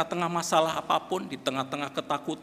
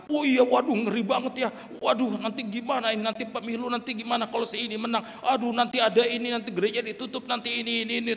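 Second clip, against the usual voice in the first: fretful higher-pitched worry, piling up; mock panic; 7 semitones higher than usual. Congregation fretting over election rumours, mock panic. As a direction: imitate anxious people fretting over rumours.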